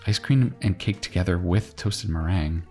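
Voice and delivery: ordinary delivery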